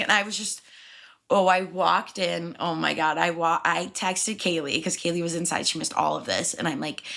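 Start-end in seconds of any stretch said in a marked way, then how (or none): none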